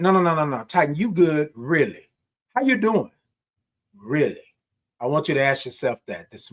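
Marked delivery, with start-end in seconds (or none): 0.0-4.4 lightly voiced caring friend; insistent self check-in. Pressing himself to answer honestly, gently insistent. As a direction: press yourself, really asking.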